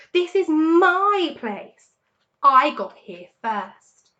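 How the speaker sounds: slow, high, loud shriek; shrieking rat. A narrator's reading. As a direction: drag it out, shrieking. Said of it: "shrieked Rat".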